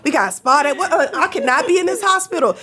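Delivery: high, pleading, laughing protest; protesting high-pitched. Retold or imitated. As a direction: squeak out the excuses like a panicked patient. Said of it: "And I'm like".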